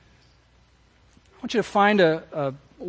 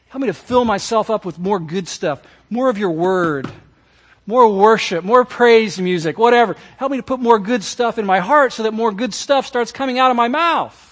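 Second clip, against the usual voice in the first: louder, rising plea; fervent prayer; 5 semitones higher, 6 dB louder than usual. Acted. Pastor voicing a sinner's plea to Jesus, fervent. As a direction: build the prayer up with force.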